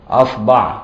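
أصبع said asbaʕ with a on both the hamza and the b; vowel variants. Shown as a demonstration. Teacher to students, أصبع said asbaʕ. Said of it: "يعني بضم وفتح وكسر الهمزة".

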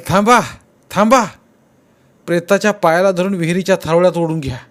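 high, loud rising calls to halt; shouting stop. A narrator's reading. Constable yelling to the pullers to stop, loud. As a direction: stop them with a sharp yell. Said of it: "जमादार ओरडला".

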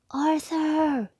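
high excited shout back; happy answering yell. Acted. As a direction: call his name out gladly. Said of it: "yelled Billy".

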